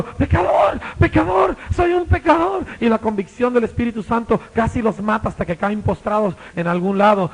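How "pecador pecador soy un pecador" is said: high, loud cry of a repentant sinner; shouting sinner. Retold or imitated. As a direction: scream out the confession. (grito desesperado de pecador arrepentido)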